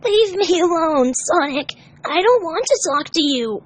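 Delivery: high, slow tearful plea. Acted. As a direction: push him away through tears. Tails crying, hurt.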